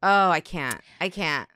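usual speaking voice

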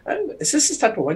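squeaky high put-on voice, quick. A friend voicing a squeaky bit character, high and quick. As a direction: pitch way up and rush it. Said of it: "It's like".

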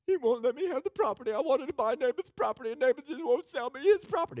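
high-pitched mock whine, hammed up; exaggerated tearful sulk in a squeaky voice; whining crybaby king. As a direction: wail it like a spoiled child. Acts out sulking, crying king Ahab.

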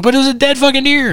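high, loud, incredulous protest; exasperated yell. Retold or imitated. Driver protests to sleepy friends, high exasperated shout. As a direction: throw up your hands and shout it.

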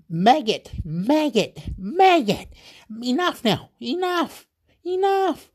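very high-pitched, repeated cries; exasperated outburst. Retold or imitated. Bloke who has had enough, exasperated high outburst. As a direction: retell his outburst in short, climbing bursts.

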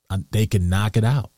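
ordinary talk, nothing marked

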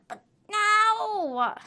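high, loud demanding bark. Shown as a demonstration. Showing how Alex talks, loud and demanding. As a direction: snap it out like a boss. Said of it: "in a demanding voice".

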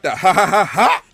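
high, loud cackle imitation; imitating a cocky champ's laugh; mock heel laugh. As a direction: do her cocky ha ha ha. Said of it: "you still got that laugh".

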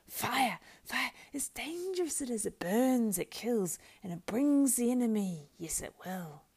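high creature voice, urgent and alarmed; hissing warning. A narrator's reading. Gollum warns that fire brings the enemy, alarmed. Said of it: "hissed Gollum".

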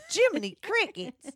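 high, loud mock gasp of surprise; mock exclamation. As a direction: squeal it like a cartoon shock. Mock exclaim echoing co-host.

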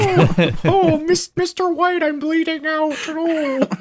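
very high, wailing puppet voice; hammed-up dying movie character as a squeaky Muppet; Kermit. Mocking Tim Roth's bleeding-out scene for friends, in a Kermit voice. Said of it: "it's literally your Kermit".

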